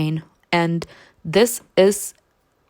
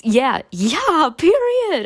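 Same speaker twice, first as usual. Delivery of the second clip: sassy emphasis; 8 semitones higher, 4 dB louder, much slower than usual. Real, not acted.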